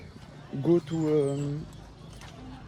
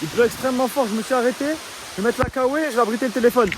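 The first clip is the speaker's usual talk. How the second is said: raised voice; 7 semitones higher, faster than usual. Real, not acted. Talking over heavy rain.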